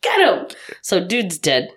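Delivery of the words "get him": high, eager urging; re-enacted cheering on. Moviegoer sitting up cheering the hero, excited urging. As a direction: egg the hero on.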